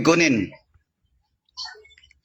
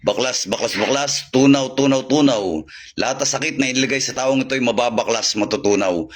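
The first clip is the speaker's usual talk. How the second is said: healing incantation. Acted. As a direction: intone it like a charm. Healing incantation after prayers.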